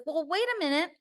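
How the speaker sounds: high-pitched, hesitant questioning voice; acted confusion, high and faltering; imitating unsure listeners. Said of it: "some of you will be like".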